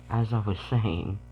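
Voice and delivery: usual speaking voice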